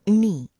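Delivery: "ni" alone, loud and firmly stressed; stressed syllable. Shown as a demonstration. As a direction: hit "ni" hard. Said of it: "quite a bit of stress on this particular syllable".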